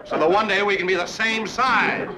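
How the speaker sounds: high, loud mock-eager rant; sarcastic outburst. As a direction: rant sarcastically at the top of your range. Sarcastic outburst over Elroy.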